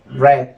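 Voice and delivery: Red with a short open e; pronounced like the colour red. Shown as a demonstration. Teacher showing students how past read sounds, Red with short e. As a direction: say Red to rhyme with bed. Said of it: "como si pronunciáramos el color rojo".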